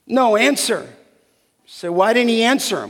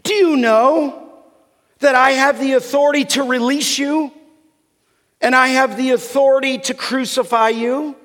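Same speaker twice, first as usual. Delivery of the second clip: higher, forceful acted indignation; Pilate, angry and incredulous; 4 semitones higher, 3 dB louder than usual. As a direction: assert your authority as an affronted governor. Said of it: "He's mad".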